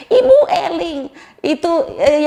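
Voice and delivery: high, loud reprimand; alarmed scolding. Retold or imitated. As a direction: call her sharply back to her senses. Nurse scolds the shouting patient.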